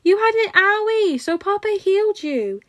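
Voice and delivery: put-on very high, loud little boy; piping toddler. A narrator's reading. Toddler explaining to the hero why he was healed, very high and bright. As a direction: pipe up brightly like a three-year-old. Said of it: "pipes up".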